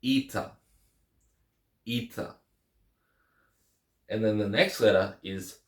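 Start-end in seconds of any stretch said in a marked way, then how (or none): 0.0-2.4 Eta said EE-tah with a long E. Shown as a demonstration. Teacher modelling Eta with long E sound. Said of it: "that long E sound".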